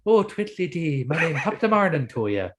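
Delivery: high, hammed-up fake Irish accent; stage Irish accent. Mocking a bad Hollywood stage Irish accent, hammed-up. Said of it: "I'm going".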